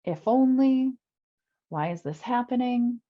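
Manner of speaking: hammed-up self-pity, crying out at fate; pity party. Complaining client mimicked by coach in a loud, hammed-up wail. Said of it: "Having a pity party".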